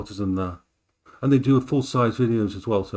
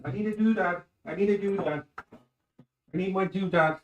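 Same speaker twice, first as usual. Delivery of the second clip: playful singsong; 7 semitones higher than usual. Real, not acted. Playful repeat of silly word.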